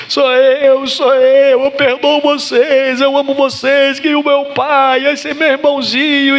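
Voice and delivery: high-pitched tearful cry, loud at the start; bawling in tears. Acted. Joseph revealing himself to his brothers, bawling in tears. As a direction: act out wailing through every drawn-out word. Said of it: "o José aos berros".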